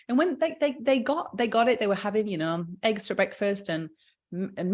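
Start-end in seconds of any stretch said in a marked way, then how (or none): none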